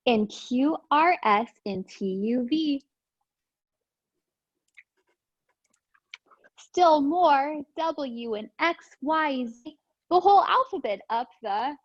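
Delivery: lively storybook read-aloud; sing-song picture book reading; reading to children. Acted. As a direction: read it bouncy for little kids. Reads picture book to class.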